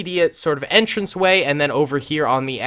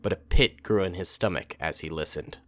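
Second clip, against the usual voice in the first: low, measured story reading; story narration; 6 semitones lower, 8 dB quieter than usual. A narrator's reading. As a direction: narrate the tale in a low, even voice. Reads the story aloud.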